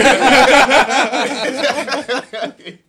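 loud burst of laughter; cracking up loudly. Real, not acted. Big laugh at joke.

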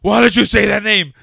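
high, loud movie-hero demand; mock dramatic yell. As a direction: ham up the outraged question. Acting out the film's line.

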